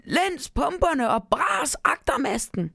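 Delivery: high-pitched bellowed ship's orders; storybook captain shouting orders; captain's roar. A narrator's reading. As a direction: call out commands to the crew. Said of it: "brølede han".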